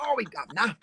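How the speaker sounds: high comic Cockney; hammed-up Cockney cheek; playful mock Cockney; Cockney accent. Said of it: "now say it with a Cockney accent".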